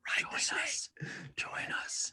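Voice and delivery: eerie cult whisper, half joking; creepy whisper. Pretend. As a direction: whisper it like a sinister cult inviting you in. Joking about joining evil empire.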